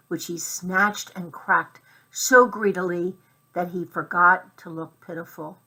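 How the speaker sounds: ordinary delivery, nothing marked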